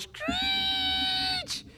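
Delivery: very high, thin squeal; chalkboard screech. Retold or imitated. Describing nails on a chalkboard with a high thin screech. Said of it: "teacher used to take their hands and go across the board".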